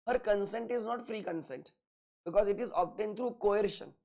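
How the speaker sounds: very slow, word by word dictation; dictating notes. Shown as a demonstration. Teacher to class, dictating notes very slowly. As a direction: spell it out slowly so they can write.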